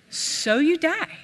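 put-on slow, high, shrugging old man; loving impression of papa shrugging off death; grandpa's funny voice. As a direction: drawl it out like a carefree grandpa. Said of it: "the way he talked was so funny".

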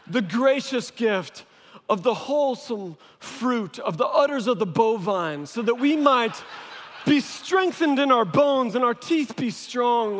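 high, loud, hammed-up pleading prayer; mock pompous prayer. Kids begging dad for milk as if praying, mock-grandiose. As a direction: overdo the pious pleading, easing down to the amen. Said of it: "Stop talking like that".

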